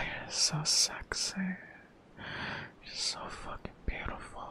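soft, close sensual whisper; breathy intimate whisper. Acted. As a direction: whisper it like a secret to a lover. Intimate whispered audio for partner.

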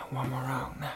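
whispered dare; whispering. Acted. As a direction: whisper the challenge. Quiet challenge.

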